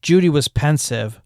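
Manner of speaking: ordinary delivery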